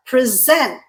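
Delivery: "present" as pre-ZENT; stress on second syllable. Shown as a demonstration. Teacher introduces the verb, "present" stressed on SENT. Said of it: "the way I pronounced it".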